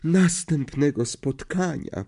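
high, slow, faint swooning farewell. A narrator's reading. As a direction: sigh out a languid goodbye. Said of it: "omdlewającym tonem".

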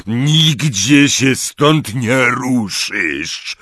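slow booming command; thundering Hagrid. Acted. Hagrid thunders at Harry not to move, slow and booming. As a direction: roar it slowly like a big giant. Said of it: "zagrzmiał Hagrid".

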